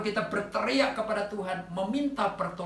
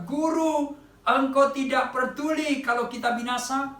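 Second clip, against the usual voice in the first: raised, pleading call to the teacher; frightened cry for help; 6 semitones higher, 5 dB louder than usual. Retold or imitated. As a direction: cry out to the master over the storm. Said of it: "murid-murid berteriak bersama-sama".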